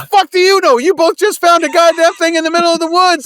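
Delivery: very high, loud yelling; exasperated shout. Acted. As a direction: shout in disbelief. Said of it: "I'm like".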